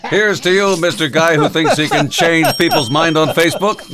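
jingle-style announcer salute; mock ad salute. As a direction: proclaim a grand mock tribute. Parody of a beer-ad salute.

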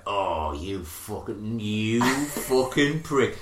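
drawn-out retold curse, starting low; slowly building anger. Bill working himself up at Big Head, mock building anger. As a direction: let it build slowly from the toes. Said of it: "see it come from his toes, build all the way up".

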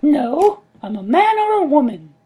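high squeaky little creature; small fairy voice, very high; tiny creature voice. Acted.

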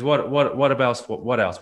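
mock the counselor's pushy questioning; pressing counselor. Counselor pressing in retold story.

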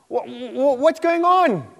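high, stammered cry of confusion; acted baffled protest; bewildered disappointment. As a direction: stretch it into a loud, slow wail. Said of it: "he was disappointed".

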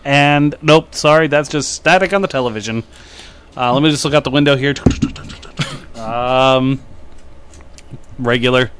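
loud, hammed-up TV weatherman; mock weatherman. Weatherman reporting from the weathercopter, loud mock broadcast. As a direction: deliver the forecast like a cheesy chopper reporter. Playing weatherman Chopper Dan.

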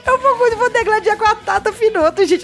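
high, giggly nervous voice; nervous laughing. Real, not acted. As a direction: talk through nervous giggles. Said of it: "Eu tô nervosa". (rindo de nervosa)

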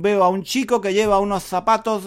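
usual speaking voice

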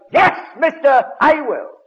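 high, loud and slow cry of yes; retold yell. A man in the church answering the preacher, yelled. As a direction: answer the call at the top of your voice. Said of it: "jumped up and yelled".

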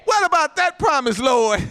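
high, forceful cry to God; impassioned pulpit challenge; Moses demanding. Acted. Moses challenges God over promise.

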